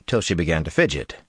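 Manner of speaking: ordinary delivery, nothing marked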